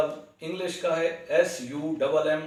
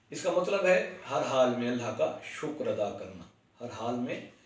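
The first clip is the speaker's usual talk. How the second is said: normal talking voice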